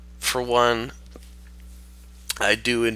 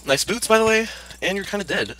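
mocking; 10 semitones higher than usual. Taunting a defeated enemy.

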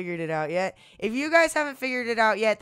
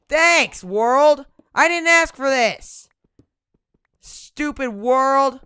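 loud, high sarcastic complaint; angry outburst; 5 semitones higher, 10 dB louder, slower than usual. Real, not acted. Gamer ranting at game world, angry sarcastic shout. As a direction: snap at the world that tricked you.

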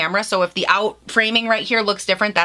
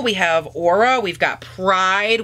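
usual speaking voice

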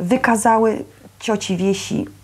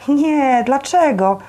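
high, louder, overdone denial; insincere reassurance; 8 semitones higher, 5 dB louder, slower than usual. A narrator's reading. Mother answering the disappointed boy, not quite sincerely. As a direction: reassure him a bit too eagerly. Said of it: "niezbyt, jak mu się zdawało, szczerze".

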